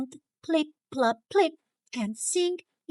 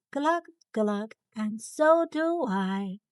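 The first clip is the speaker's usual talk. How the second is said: usual speaking voice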